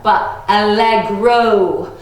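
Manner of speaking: "allegro" slow, every syllable clear, stress on "le". Shown as a demonstration. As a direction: spell out "allegro" slowly for kids. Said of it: "Not like Allegra or Alexa".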